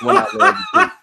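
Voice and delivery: laughing. Real, not acted. Laughing at his reversal.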